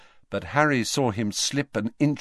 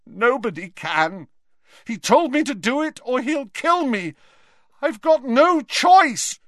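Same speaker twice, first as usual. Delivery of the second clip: much higher, louder, near breaking; desperate panic; 7 dB louder than usual. A narrator's reading. Terrified Malfoy confessing to the headmaster, high and desperate. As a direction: plead in rising terror.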